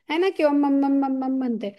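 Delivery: normal talking voice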